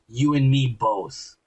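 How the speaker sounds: low, slow, grim mock anger; Master Chief angry. Master Chief answering the girl, low and slow retold anger. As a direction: drop the voice low and drag it out, half-angry. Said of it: "He says it angry".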